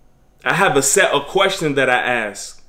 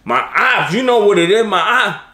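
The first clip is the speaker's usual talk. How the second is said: higher, louder imitation of a tough girl; acting tough; 6 semitones higher, 4 dB louder than usual. Imitating a tough girl bragging about her ops, higher and louder. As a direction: throw some attitude like a tough teen. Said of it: "But they were acting tough".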